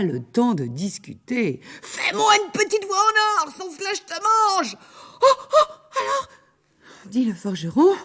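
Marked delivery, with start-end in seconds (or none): 1.8-4.8 high, menacing ogress screech; threatening ogress. Acted. L'ogresse menace le forgeron. 5.2-6.3 high, loud startled yelp; frightened blacksmith. Acted. Forgeron effrayé.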